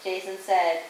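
plain reading voice

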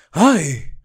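high, sharp cry of ay; throat-cut scream. A narrator's reading. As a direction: cry out as if being slaughtered. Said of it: "como si la degollaran".

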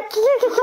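high teasing giggle, rising at the end; taunting cackle. Real, not acted. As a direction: cackle to rub it in.

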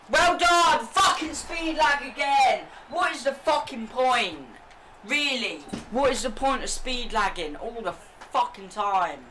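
furious shouting rant; raging gamer. Real, not acted. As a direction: yell at the game in rage. Raging at speed lag.

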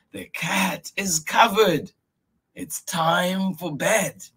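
high voice, slowing to bed; patient grandfather. Acted. Grandfather reminding Miyuki it's bedtime. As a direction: list the chores, then close firmly. Grandfather lists done chores.

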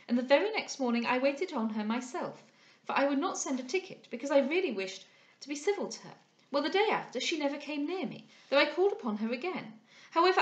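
high-pitched, huffy society lady; prattling young lady, affronted. Acted. Young lady refusing to discuss her rival, affronted. Voices Miss Larolles.

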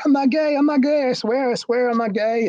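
mock frantic protest, shooting up in pitch; imitated tearful protest. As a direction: imitate desperate pleading in a high, strained pitch. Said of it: "then he started crying".

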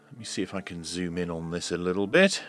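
ordinary talk

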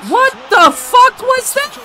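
high, slow stunned shout; shocked yell. Real, not acted. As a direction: yell it in disbelief. Shocked at a bad play.

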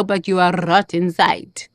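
ordinary talk, nothing marked

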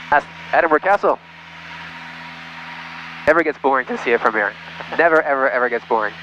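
ordinary delivery

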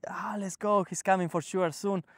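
lightly mimicked eager anticipation; retold excitement. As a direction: mildly imitate excited followers awaiting their guru. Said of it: "excited because he's going to give".

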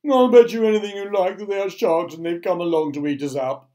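loud, high panicky wail; alarmed crying out. A narrator's reading. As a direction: wail it like a doom-laden worm. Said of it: "cried the earthworm".

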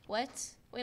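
normal talking voice

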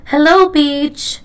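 loud, high hello to the beach; excited greeting. A narrator's reading. Splat arriving at the beach, calling out excitedly. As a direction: greet the beach with a happy cry.

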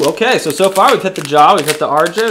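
ordinary talk

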